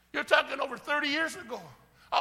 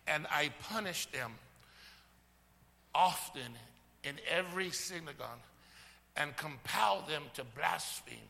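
low, measured Bible reading; scripture reading; 8 semitones lower, 5 dB quieter, slower than usual. Acted. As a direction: read the verse lower and deliberately, with pauses. Reads the verse aloud.

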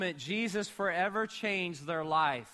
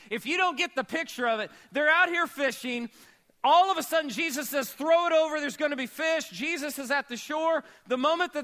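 full-force preaching; 8 semitones higher, 6 dB louder than usual. Real, not acted.

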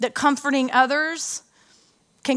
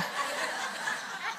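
big burst of laughter; 13 dB quieter than usual. Real, not acted. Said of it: "That was way too big of a laugh".

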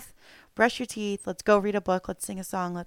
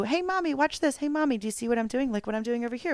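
put-on much higher, eager child voice; kids calling Mommy; 4 semitones higher, faster than usual. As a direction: pitch up high like a little kid begging for attention. Mother imitating her kids' questions.

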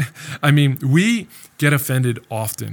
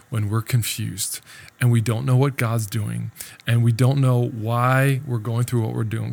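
usual speaking voice

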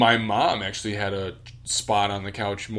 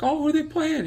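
high, airy mock-mom voice; imitating mom's sweet high question; 3 dB louder than usual. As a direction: play the clueless mom, way up high. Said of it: "She'd be like".

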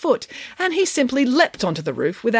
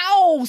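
pained yelp; 13 semitones higher, 5 dB louder than usual. Acted. Pig falls onto toys.

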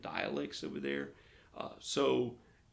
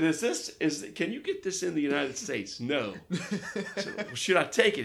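higher, loud, laughing questions; half-laughing nervous questioning; anxious questions; 6 semitones higher, 9 dB louder than usual. Retold or imitated. Pastor to missionary about the pill, loud laughing disbelief.

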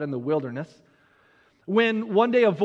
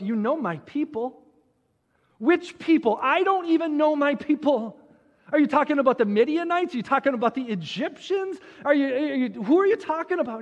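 higher, quickening mock confusion; baffled questioning; 5 semitones higher than usual. Moses questioning God about his people, increasingly baffled. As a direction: fire off puzzled questions, climbing in pitch. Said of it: "Moses is thinking to himself".

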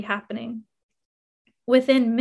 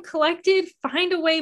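ordinary delivery

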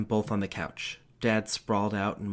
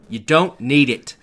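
cold father; 5 semitones higher, 14 dB louder, slower than usual. A narrator's reading. Dad dismisses.

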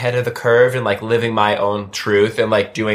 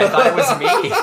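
high-pitched panic; 14 semitones higher than usual. Retold or imitated. Retelling own panic, laughing.

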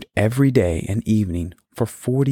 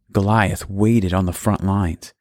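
plain reading voice

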